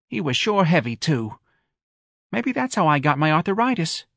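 high, plaintive mother; complaining Mrs. Riley character. A narrator's reading. Mother telling friends about her bad feet, high complaining voice. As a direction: voice a mother listing her ailments.

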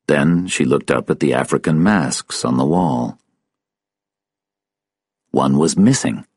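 ordinary delivery

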